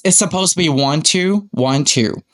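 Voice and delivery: ordinary talk, nothing marked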